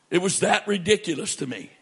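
usual speaking voice

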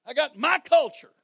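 high, loud mocking voice of a proud excuse-maker; mock objector. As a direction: parody someone clinging to their culture.

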